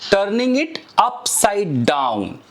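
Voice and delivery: slow, careful English model sentence. Shown as a demonstration. Teacher modeling an English phrase slowly for students. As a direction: spell it out slowly for learners. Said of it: "मैं क्या बोल सकता हूं".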